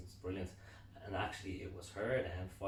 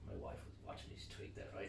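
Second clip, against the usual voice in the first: ordinary talk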